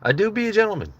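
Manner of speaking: high, hammed-up gentleman voice; mock gentleman. Streamer jokes to chat in a high mock gentleman voice. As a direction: camp it up as a dainty gentleman. Jokey posh gentleman line.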